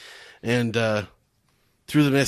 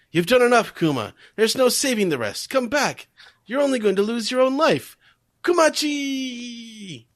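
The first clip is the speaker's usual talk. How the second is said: high pleading calls to Kuma; calling out; 11 semitones higher than usual. Retold or imitated. Townspeople calling Kuma back from the fire, pleading. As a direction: beg him to come back from the fire. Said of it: "people are saying".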